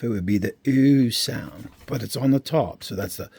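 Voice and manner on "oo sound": oo said as in "food", drawn out; long oo sound. Shown as a demonstration. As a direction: stretch oo, louder than usual. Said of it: "If the dot was midway".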